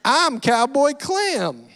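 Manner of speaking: put-on a kid proudly in character, stretching it out slow and high; slow, high little-cowboy swagger; Cowboy Clem voice. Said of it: "I would go".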